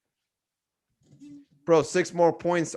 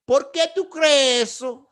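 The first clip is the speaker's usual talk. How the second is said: higher, slow, pointed challenge; argumentative tone; 10 semitones higher, 5 dB louder than usual. Pretend. Imagined challenge to the old lady, slow and argumentative. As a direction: demand she explain herself. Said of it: "that argumentative tone of voice".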